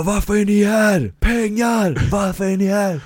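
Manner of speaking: high chanted call and answer, shouting; hyped pep-talk shout. Acted. Sales boss chanting at his team about money. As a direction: pump up the crowd of sellers. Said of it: "Jag bara".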